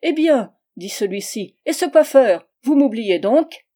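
a husband calling out for his forgotten barber; impatient call. A narrator's reading. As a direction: bark for attention from the doorway. Husband returns demanding the barber.

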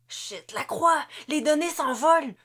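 the data slipping away, plead; frightened soldier calling the chief; panicky plea. A narrator's reading.

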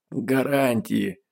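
slow, pained, grimacing word; struggling to speak. Acted. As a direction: squeeze it out through a wince. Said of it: "ей было очень трудно говорить".

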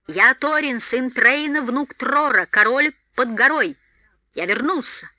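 high, loud royal announcement; exclaimed proclamation. A narrator's reading. Dwarf king announcing himself to the feasting hall, ringing exclamation. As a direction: proclaim your titles to the whole hall. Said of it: "воскликнул Торин".

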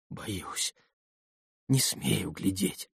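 frightened breathless whisper. A narrator's reading. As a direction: whisper it, too awed to look. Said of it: "прошептал Алёша".

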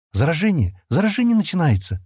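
high-pitched alarmed question and cry; a touch of panic; frightened. A narrator's reading. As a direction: voice jumps up in mild acted fear. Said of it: "испугалась Келла".

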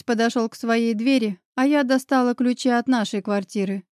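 plain reading voice

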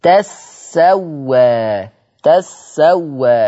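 "تسوى" drawn out as tas-sa-wa; إدغام التاء في السين. Shown as a demonstration. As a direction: hold the doubled sin in "تسوى", very slowly. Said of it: "فأضغمت التاء الثانية في حرف السين". ("تسوى" بسين مشددة ممدودة)

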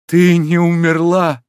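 high, loud, drawn-out cry of disbelief; stunned exclamation. A narrator's reading. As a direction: cry it out slowly in amazement. Overjoyed hero finds her alive.

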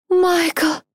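высокий отчаянный вскрик; выкрикнуть имя в отчаянии; отчаянный крик. Acted. Героиня вспоминает погибших. (отчаянный вскрик)

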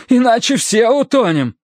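high, urgent chattering warning; teeth chattering. A narrator's reading. Terence warns the boat may sink, teeth chattering. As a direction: warn through cold-clenched teeth. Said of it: "клацая зубами".

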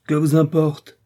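high, loud stranger's retort; strident voice. A narrator's reading. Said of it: "répondit une voix stridente".

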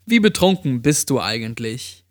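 usual speaking voice

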